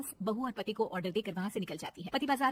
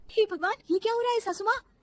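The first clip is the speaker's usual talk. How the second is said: mock shock; 9 semitones higher, 5 dB louder than usual. Bride shocked at frozen mother-in-law.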